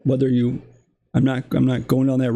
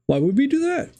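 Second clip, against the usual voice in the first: mock elitist; 12 semitones higher than usual. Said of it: "Democrats say".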